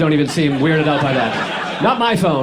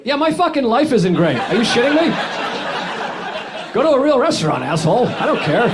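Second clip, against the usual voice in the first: mock angry employee; 8 semitones higher than usual. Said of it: "McDonald's employee".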